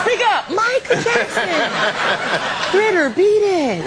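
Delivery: loud, high, slow mock demand; mock shout. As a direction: bellow it teasingly, slowly. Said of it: "He talked so low".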